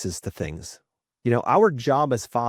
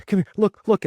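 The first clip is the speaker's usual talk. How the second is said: put-on 1 urgent kid; 12 semitones higher, 6 dB louder than usual. Son calling dad to see.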